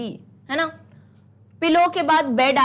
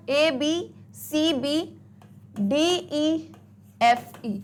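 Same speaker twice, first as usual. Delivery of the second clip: letters drawn out slowly one by one; slow spelling out; much slower than usual. Shown as a demonstration. Teacher spells out the rhyme scheme slowly. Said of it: "rhyme scheme क्या आई है".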